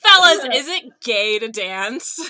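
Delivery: loud, slow proclamation; mock-outraged question. Co-host mockingly asks the fellas, loud and slow. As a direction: put it to the crowd, drawn out and loud.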